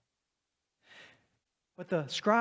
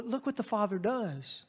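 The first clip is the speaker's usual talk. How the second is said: usual speaking voice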